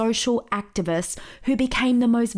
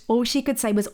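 normal talking voice

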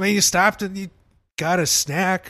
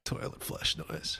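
whispered; 12 dB quieter, slower than usual. Acted. Repeating a soundboard request.

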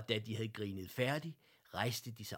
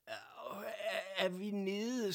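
dazed stammer; 11 semitones higher, much slower than usual. Acted. Dazed bear after crashing downstairs.

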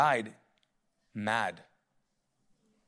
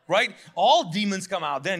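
usual speaking voice

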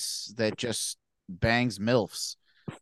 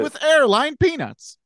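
Seinfeld impression; 6 dB louder than usual. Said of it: "What's the deal".

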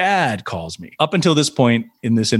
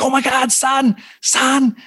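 much higher, gushing immigrant dad; excited dad imitation. Dad calling his son late at night, high excited gushing. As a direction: gush like a thrilled dad with a heavy Chinese accent. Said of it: "he's like".

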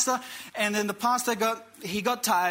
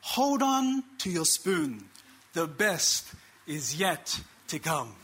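slow, measured punchline, dropping lower; leaning-over confidence; 5 semitones lower, slower than usual. Retold or imitated. Someone leans over at potluck before dessert, slow retold confidence. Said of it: "someone would inevitably lean over and say".

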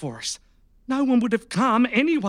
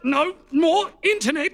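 triumphant shout; 6 semitones higher than usual. Acted. Captor cuts the cable.

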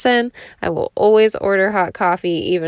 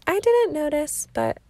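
a higher, sweet partner's voice; Jenna impression; 12 semitones higher, 4 dB quieter than usual. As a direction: lightly imitate a sweet, agreeable girlfriend. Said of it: "That's my Jenna impression".

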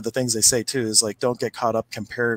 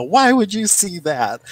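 slow, higher, louder tearful protest; tearful lady; 9 semitones higher, 6 dB louder, slower than usual. Retold or imitated. A retold lady upset at the reader, mock tearful and slow. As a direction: plead through mock tears, upset. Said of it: "she starts bawling".

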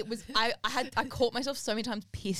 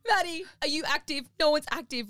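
raised, urgent plea for a reply; 6 semitones higher than usual. Retold or imitated. Friend frantically messaging to see who is online, urgent. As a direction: imitate a friend urgently pinging for help. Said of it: "I get this urgent".

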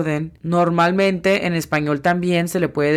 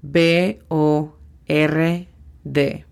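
D E S K T A P spelled out slowly, letter by letter; much slower than usual. Shown as a demonstration. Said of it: "La pronunciación fonética es". (deletreo lento, letra por letra)

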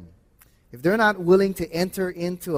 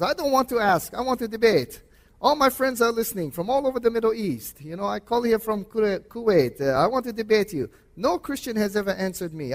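put-on high-pitched, amateur Middle Eastern accent; 6 semitones higher than usual. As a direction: imitate an insistent radio caller with a rough Middle Eastern accent. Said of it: "in his Middle Eastern accent, I can't do it very well".

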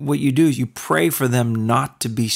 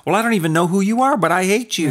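higher mock voice of a prejudiced person; mock bigot; 7 semitones higher, faster than usual. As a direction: sneer it, pitched up. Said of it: "You speak with an Irish dialect".

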